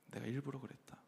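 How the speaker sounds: quiet echo of the admission; softly repeated. Retold or imitated. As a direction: drop the repeat to a near-hush. Superior repeats, much softer.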